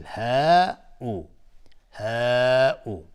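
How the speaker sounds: ها او with a long-held aa in ها; permissible madd. Shown as a demonstration. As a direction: stretch ها slowly, then a short او. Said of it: "مد اول مد جایز منفصل".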